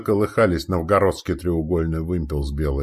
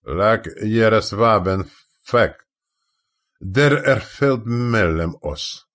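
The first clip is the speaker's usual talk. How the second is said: slow, raised call in Danish; drawn-out carrying shout; shouting from the mast; 3 semitones higher, 3 dB louder, much slower than usual. A narrator's reading. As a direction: hail the other crew word by word. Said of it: "прокричал с мачты на родном языке Трюгве".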